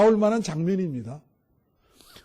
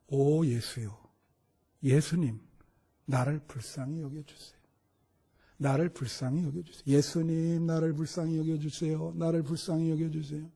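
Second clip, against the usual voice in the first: soft, lower, repeating plea; repeated prayer; 3 semitones lower, 7 dB quieter than usual. Acted. Preacher models monks' repeated prayer, soft and low. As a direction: murmur the plea over and over. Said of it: "계속 반복해서".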